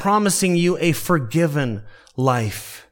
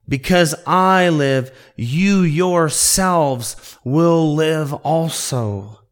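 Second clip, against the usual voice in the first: slow, stress on you; very emphatic quote; slower than usual. Shown as a demonstration. Preacher showing how emphatic Jesus' words are, slow. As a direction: drag it out, leaning on you. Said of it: "And it's very emphatic".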